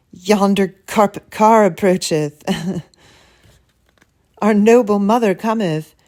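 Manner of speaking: put-on pompous old-fashioned announcing; mock-archaic speech. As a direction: show off like a storybook noble. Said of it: "People talk like that in the books".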